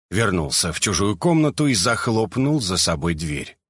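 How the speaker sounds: usual speaking voice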